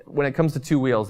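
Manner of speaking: normal talking voice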